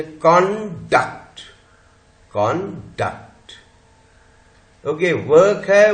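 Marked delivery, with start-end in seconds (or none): 0.0-1.4 कॉन्डक्ट said in two distinct parts; pronunciation demo. Shown as a demonstration. Teacher showing students how it is pronounced, careful demonstration. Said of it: "अब देखिए इसका pronunciation है". 2.3-3.5 कॉन्डक्ट slowly, split into two syllables; slow pronunciation demo. Shown as a demonstration. Teacher repeating the word for students, slow demonstration. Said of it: "अब देखिए इसका pronunciation है".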